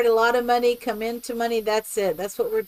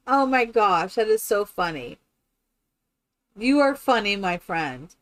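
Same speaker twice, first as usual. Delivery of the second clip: ordinary talk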